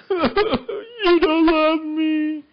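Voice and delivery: very high, very slow whiny sob; hammed-up manipulative wail; mock weeping. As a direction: drag it out in a fake cry. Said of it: "Just by a little bit of".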